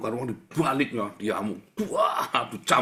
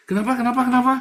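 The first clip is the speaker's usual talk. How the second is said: much higher, louder squeaky repeated question; high-pitched reply; 12 semitones higher, 10 dB louder, faster than usual. Retold or imitated. Child answering the whisperer, high squeaky questioning. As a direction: squeak back as the puzzled child. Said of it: "hati-hati".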